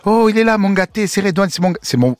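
put-on high, loud old neighbourhood lady fussing; vieille du quartier. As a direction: gush over your little darling, high-pitched. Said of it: "c'était les vieilles du quartier". (voix aiguë de vieille du quartier marseillais)

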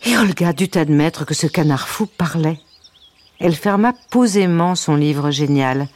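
ordinary delivery, nothing marked

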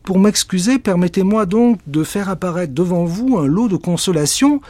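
hammed-up witch incantation; grand witch. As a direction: announce the gift and cast. Witch offers, casts.

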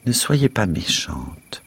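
put-on slow, gentle, as if to a child; slow patient coaxing. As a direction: spell it out slowly and kindly. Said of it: "me parla lentement, comme si j'étais un peu bête".

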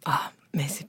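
soft, whispered retold reaction; quietly taken aback, almost whispering; hushed realization. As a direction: let out a stunned, voiceless little "ah". Retold stunned reaction to feedback.

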